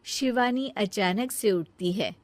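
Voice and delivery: normal talking voice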